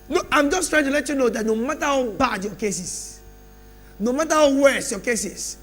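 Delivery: loud, rising sermon bursts with long pauses; preaching at full force. Acted. Preacher promising the congregation change, loud and rising. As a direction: drive the promise home to the church.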